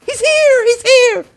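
high, loud screams for help; panicked. Real, not acted. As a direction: shriek in panic as the attacker closes in. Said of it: "I panicked and threw my machete".

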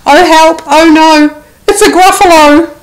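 high, loud frightened squeal; panicked cry. Acted. Mouse crying out in terror at the gruffalo. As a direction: shriek as if you've seen a monster.

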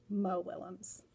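plain reading voice

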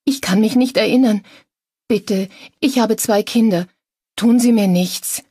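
high, loud pleading; terrified captive's whimpered plea; whimpering. A narrator's reading. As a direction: beg for your life in a frightened high voice. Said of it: "sie wimmerte wieder".

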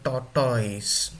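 "tortoise" wrongly said tor-TOYS; mispronounced tortoise. Shown as a demonstration. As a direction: give "tortoise" a full "toys" ending. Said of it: "It is not tortoise".

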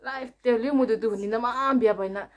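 normal talking voice

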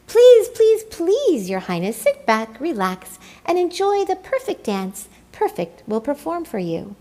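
fawning cry. A narrator's reading. Penguins begging the emperor to sit, loud fawning. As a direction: beg him eagerly to sit.

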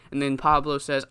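ordinary talk, nothing marked

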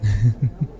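brief, light chuckle; a quick little laugh to yourself; short chuckle. Acted. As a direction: chuckle under the breath. Amused before saying hi.

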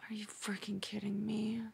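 breathless whisper of disbelief; stunned whisper. Real, not acted. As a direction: hiss it under your breath at the screen. Disbelief at the episode.

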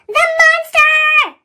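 high, loud, slow cry of fright; a scared engine's startled cry; frightened wheeze. A narrator's reading. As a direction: gasp out in terror. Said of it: "wheezed James".

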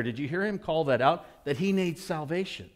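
ordinary talk, nothing marked

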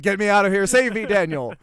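high, loud, hammed-up cry for help; mock distressed plea. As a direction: whine to be rescued, loudly.

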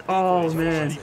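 usual speaking voice

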